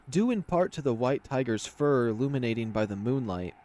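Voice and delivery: usual speaking voice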